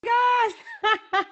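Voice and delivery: thrilled squeal. Real, not acted. Caller reaching the hosts live, squealing with excitement. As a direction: squeal it like a starstruck fan.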